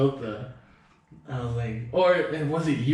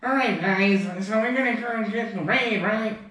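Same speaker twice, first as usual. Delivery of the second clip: high, nasal-free nerdy gamer voice, pitched up; nerd voice; 8 semitones higher than usual. Retold or imitated. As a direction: do a typical nerd calling the raid. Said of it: "typical nerd, like, voice".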